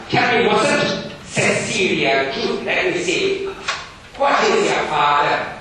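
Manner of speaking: loud, high, stern schoolmaster. Acted. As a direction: bark it like a strict Victorian teacher. Gradgrind questioning.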